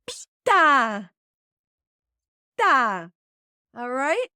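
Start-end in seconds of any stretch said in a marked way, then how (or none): 0.1-3.1 "Pinta" loud and firm, final "Ta" repeated; Spanish command. Shown as a demonstration. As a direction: say "Pinta" firmly, then echo "ta". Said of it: "The second command form is".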